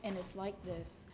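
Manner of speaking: a light take on a man who never changes pitch; monotone Chris. Retold or imitated. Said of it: "He has one tone".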